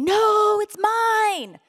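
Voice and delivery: high, loud grabbing protest; snatch it back, possessive and defiant; clinging refusal. Pretend. Believer holding on with closed fists, high loud refusal.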